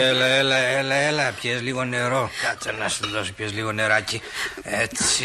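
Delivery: low, gentle coaxing; soothing. Acted. Shopkeeper coaxes the shaken girl to drink, low and soothing. Calming the frightened girl with water.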